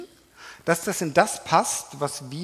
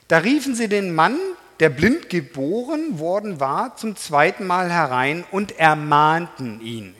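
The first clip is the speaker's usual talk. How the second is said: scripture reading; 6 dB louder than usual. Acted. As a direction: read the verse out with weight. Reads the Bible passage aloud. (langsame, feierliche Bibellesung)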